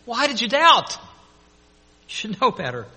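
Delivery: a fond teacher scolding a slow pupil; Jesus lifting Peter from the waves, chiding him kindly; gently chiding Jesus. Retold or imitated. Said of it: "caught him and said".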